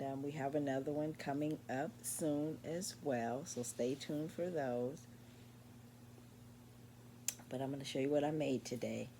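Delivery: ordinary talk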